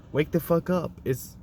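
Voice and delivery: ordinary talk, nothing marked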